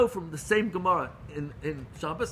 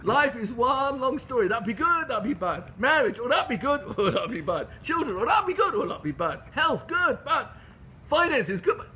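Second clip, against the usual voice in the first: playful refrain; 7 semitones higher, 4 dB louder than usual. Acted. Applying the joke's refrain to life.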